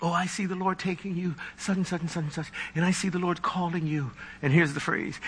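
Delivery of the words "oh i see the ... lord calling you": hushed, breathy-free mock prophecy, softer; lightly voicing a dreamy false prophet; a hint of a syrupy prophet, much softer. Mocking a vague prophetic word.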